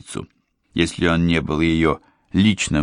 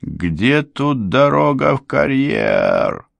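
голос из тумана; 9 semitones higher, slower than usual. A narrator's reading. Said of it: "голос из тумана".

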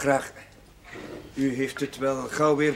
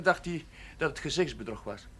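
usual speaking voice

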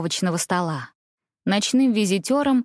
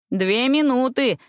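shouting; 5 semitones higher, slower than usual. A narrator's reading. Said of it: "крикнул Бенедикт".

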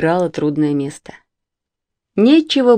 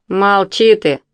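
through gritted teeth; 3 semitones higher, 3 dB louder, slower than usual. A narrator's reading. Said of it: "процедил сквозь зубы Лёня".

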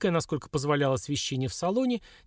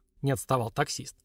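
plain reading voice

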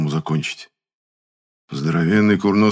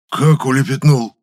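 loud, slow, raised-pitch choked disbelief; hoarse wheeze; 7 semitones higher, 7 dB louder than usual. A narrator's reading. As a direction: croak it out, aghast. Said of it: "прохрипел Митрофан".

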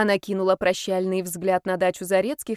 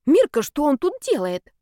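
alarmed; 7 semitones higher, 5 dB louder than usual. Acted. Ghost alarmed at brother's arrival.

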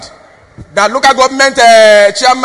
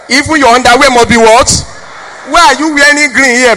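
usual speaking voice

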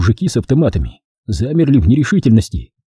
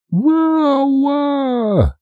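high drawn-out female wail; истошный вопль. A narrator's reading. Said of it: "истошно заорала женщина". (истошный высокий вопль)

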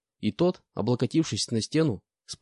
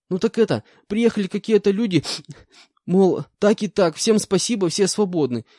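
put-on frightened young con; 6 semitones higher, 5 dB louder than usual. Terrified prisoner answers interrogation.

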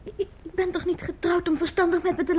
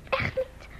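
whisper; 8 dB quieter than usual. Acted. Bange vraag aan echtgenoot.

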